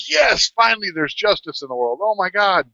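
high, exultant relief; retold jubilation. Trucker passing the pulled-over Swift driver, high triumphant cheer.

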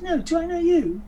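very high English dialect; lofty retort in a put-on English accent. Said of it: "if I can do an English dialect".

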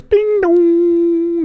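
high sung ding dong imitating a doorbell; doorbell chime. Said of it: "Did you like that?".

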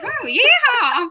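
high, loud cowgirl whoop. Retold or imitated. Performer mocking their own audition accent, cowgirl whoop. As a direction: whoop it like an over-the-top American cowgirl. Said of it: "sounded a bit like a cowgirl".

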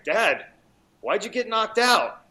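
put-on high, drawn-out kid's question; imitating a kid. Son asking dad about the lost fight, imitated kid voice. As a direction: pitch it up as his little son, stretching the words. Retells his young son's blunt question.

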